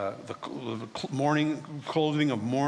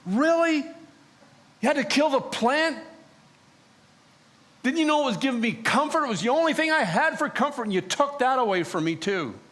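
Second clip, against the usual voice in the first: high, loud, aggrieved protest; complaining to God about the dead plant, high and loud; indignant complaint; 9 semitones higher, 4 dB louder than usual. Retold or imitated. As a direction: whine at God that he took your comfort. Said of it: "And I said to God".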